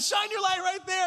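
high, loud urgent command; urgent shout. Retold or imitated. Pastor ordering brother-in-law to aim the light.

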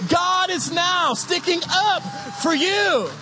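ordinary talk, nothing marked

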